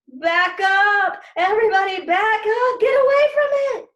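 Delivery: loud, high alarmed shout; shouted order. Retold or imitated. Teacher shouting at students to back away. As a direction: yell at the class to back away. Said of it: "Mister Corrupt ordered".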